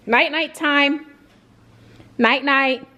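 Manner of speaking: gentle bedtime singsong; bedtime coo. Real, not acted. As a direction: lull the dogs to sleep. Putting dogs to bed.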